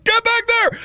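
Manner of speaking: high, loud mock command; urgent order. Triage nurse ordering patient back, high urgent shout. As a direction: yell it like a frantic nurse.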